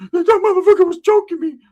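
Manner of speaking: high, loud frantic voice; shrill mock panic after a gasp; panicked girl. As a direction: blurt it out in a panic. Homegirl gasping retold.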